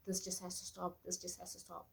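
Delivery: soft, tired muttering after a sigh; hushed weary plea to the itch; weary pleading to herself. Retold or imitated. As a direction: plead quietly to yourself, worn out.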